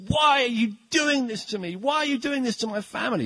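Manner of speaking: high, pleading cry of why; a desperate father begging for his family; pleading president. Retold or imitated. Preacher voicing the president begging the terrorist, high and pleading. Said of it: "he says".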